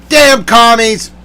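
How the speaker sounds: high, loud, drawn-out exasperation; yelled curse. Real, not acted. Cursing the commies before bed, yelling. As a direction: yell it slowly at the culprits. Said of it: "I'm gonna yell".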